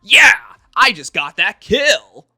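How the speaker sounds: very high and very loud, slow hammed-up bravado; mock macho gamer. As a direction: overact a tough soldier bragging. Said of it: "they'll talk more like this".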